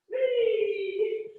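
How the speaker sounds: a high, gleeful whee; excited whee. Real, not acted. Instructor cheering kids during rocking stretch, a high gleeful whee. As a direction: squeal it like a playground swing. Scooting forward on bum.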